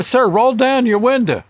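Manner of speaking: firm urgent order to a driver; cop order. Retold or imitated. Cop tells the napping father to roll the window down, commanding.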